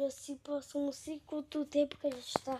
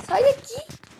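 high, loud terrified scream; shrieking at it to get away; being chased; 13 semitones higher, 15 dB louder, slower than usual. Real, not acted. Monster right behind her.

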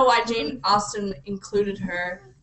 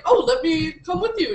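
higher-pitched eager offer; acted-out offer; 7 semitones higher, 4 dB louder than usual. Retelling a character offering to come along, mock eager. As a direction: lightly voice an eager companion.

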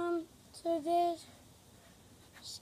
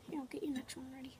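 fast unvoiced whisper; hushed aside to the viewers; 4 semitones lower, 10 dB quieter, faster than usual. Real, not acted.

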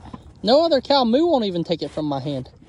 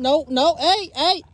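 normal talking voice

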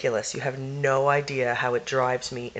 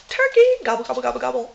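high gobbling turkey call; turkey gobble; 8 semitones higher, 4 dB louder, faster than usual. Pretend.

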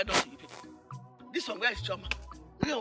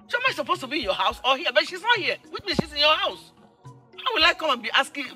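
higher, agitated argument; exasperated; 8 semitones higher, 5 dB louder than usual. Acted. Mother fed up with questions about Chioma, exasperated. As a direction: argue back in rising exasperation.

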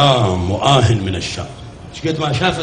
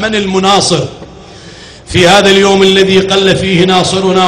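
loud, raised lament preaching; full-force sermon recitation; 3 semitones higher, 3 dB louder than usual. Acted. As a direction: drive the mourning story to the crowd.

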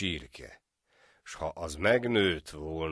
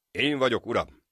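a proud, raised answer; proudly; 7 semitones higher, 6 dB louder than usual. A narrator's reading. The friend answers the judge proudly, loud and high. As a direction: announce yourself proudly. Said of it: "feleli büszkén".

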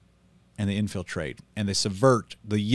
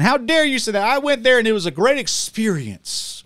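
put-on higher, whiny send-up of an offended teen; mocking young commenter; 11 semitones higher, 5 dB louder than usual. Pastor mimicking young commenters defending the conference, mocking whine. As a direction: lightly parody a nineteen-year-old fan. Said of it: "it's always some nineteen-year-old saying".

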